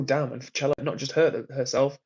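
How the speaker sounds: ordinary delivery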